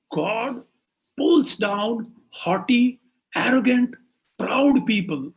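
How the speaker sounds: slow, high, loud, word by word; forceful proclamation. Acted. Child teaching the king God's daily duty, forceful. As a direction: hammer each adjective separately. Boy preaches the moral to king.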